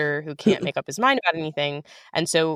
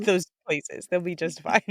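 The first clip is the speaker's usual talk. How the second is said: self-conscious laugh; 5 semitones higher than usual. Real, not acted. Embarrassed by praise, laughing.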